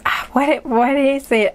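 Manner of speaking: normal talking voice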